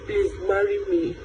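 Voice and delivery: ordinary talk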